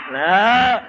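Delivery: usual speaking voice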